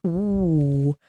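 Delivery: ordinary talk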